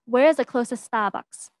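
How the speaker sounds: attempted Boston accent, dropped R in Starbucks; Bostonian accent. Shown as a demonstration. Presenter imitating the man's first sentence, Bostonian accent. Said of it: "try to say it in a Bostonian accent".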